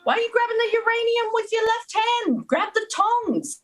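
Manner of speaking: high, far-off British voice, a mock-posh fuss; British accent. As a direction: squeal it in a silly British accent. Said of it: "British accents".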